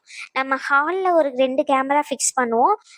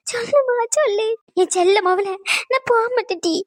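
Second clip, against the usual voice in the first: a touch of mock crying, pitched higher; tearful reunion; 5 semitones higher than usual. Mother begging her daughter to stay, in mild mock tears. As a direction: plead her not to leave, half sobbing.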